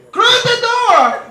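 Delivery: slow, high, loud mock bark; hammed-up boss yelling an order; boss shouting. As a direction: bellow it like a pompous boss. Boss barks order in office.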